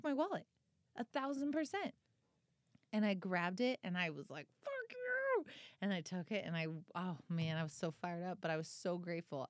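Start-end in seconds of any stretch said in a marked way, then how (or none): 4.6-5.4 high mock snap. Snapping at the thief.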